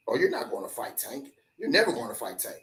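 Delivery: low, soft imitation of a naysayer; naysayer's muted dismissal, retold; mock dismissive doubter. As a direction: brush it off low and quietly, as a doubter would. Voicing doubters dismissing the fight.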